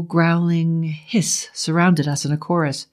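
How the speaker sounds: usual speaking voice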